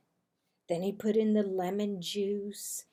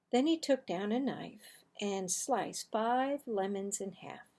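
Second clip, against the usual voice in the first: plain reading voice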